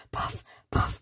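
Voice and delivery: breathy panting puff puff; out of breath puffing. Shown as a demonstration. Teacher showing how runners breathe, breathless puffing. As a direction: puff it out like you've been running. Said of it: "it makes you out of breath".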